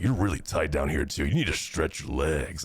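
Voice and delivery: low, serious masseur advice; deep masseur voice. Retold or imitated. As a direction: drop low and lecture like the masseur. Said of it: "he's like".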